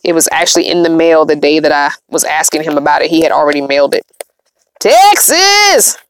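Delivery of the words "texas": high, playful cry of the state's name; triumphant announcement. Pretend. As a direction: sing out the name like a big reveal.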